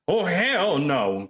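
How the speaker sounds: 2 drawn-out high mock dread; 3 stretch it out like a scared viewer; 1 mock horrified. 4 podcaster recalling horror scene, mock dread. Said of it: "kind of moment to it".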